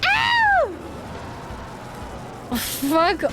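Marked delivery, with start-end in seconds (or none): 0.0-0.7 high sharp yelp; pained yelp. Real, not acted. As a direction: yelp in frustration. Timer panic outburst.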